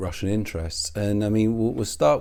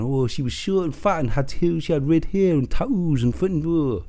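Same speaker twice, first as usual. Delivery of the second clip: mocking imitation; 6 semitones higher than usual. Mocking his rebranded pub story.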